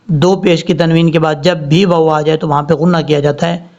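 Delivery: normal talking voice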